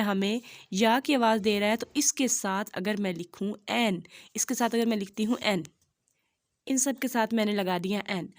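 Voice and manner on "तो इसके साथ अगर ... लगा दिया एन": ordinary delivery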